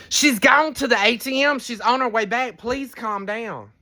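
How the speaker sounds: high pleading voice, slowing down on please calm down; imitating a flustered lady pleading, high; other lady imitated. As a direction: mimic a flustered stranger begging for calm. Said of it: "this other lady jumped in the seat and said".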